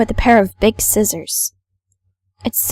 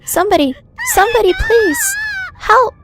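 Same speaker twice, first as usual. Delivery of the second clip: screaming for help. Acted. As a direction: shriek for help in terror.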